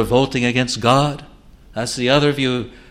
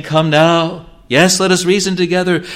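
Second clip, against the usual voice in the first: higher, louder and quicker call to come; proclaimed call; 6 semitones higher, 6 dB louder than usual. Acted. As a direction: ring it out like God calling. God's call proclaimed at full force.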